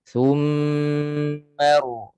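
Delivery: Thumma ru with a long humming mm; slow nasal recitation. Shown as a demonstration. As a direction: recite Thumma ru slowly, holding the mm. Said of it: "Panjangkan dengung dua harakat".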